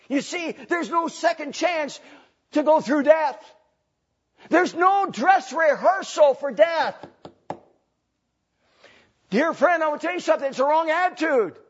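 high, loud pulpit warning; full-force preaching. Real, not acted. Preacher tells friends there's no second chance, high forceful preaching. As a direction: hammer the warning home to the crowd.

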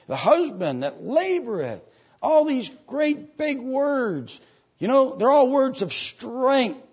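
high, drawn-out preacher's cry; emphatic preaching. Real, not acted. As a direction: stretch the big words and lift them high. Preaches with rising force.

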